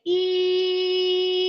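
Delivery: sustained "ee". Shown as a demonstration. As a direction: hold ee steadily on one high note. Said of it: "We're gonna say".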